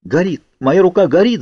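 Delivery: high-pitched pained cry. A narrator's reading. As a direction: exclaim in agony, pitch climbing. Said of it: "закричала я".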